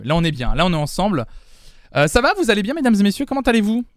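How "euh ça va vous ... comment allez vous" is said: high, bright cheerful hello to viewers; excited greeting. Real, not acted. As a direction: greet the crowd with energy.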